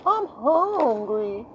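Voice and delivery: high, long drawn-out whine; whining child. Acted. Growing child asking to be fed, high drawn-out whine. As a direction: stretch it out like a whiny kid. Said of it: "They start going".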